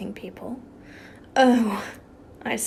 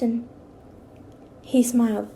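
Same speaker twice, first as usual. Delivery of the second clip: usual speaking voice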